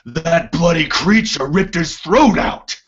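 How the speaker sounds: high, loud outburst in character; angry dwarf boss. Acted. Duergar boss gripping henchman by throat, angry outburst. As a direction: throw it at the henchman, loud and outraged. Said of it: "he grabs the, the other Duergar for, by the throat and says".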